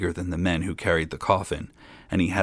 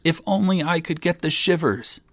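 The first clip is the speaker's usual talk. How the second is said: much higher, mounting wish; insistent lament; 10 semitones higher than usual. A narrator's reading. Young king repeating his endless wish, more insistent lament. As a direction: repeat the lament, pitched right up. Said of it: "kept on saying".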